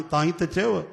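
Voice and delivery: ordinary talk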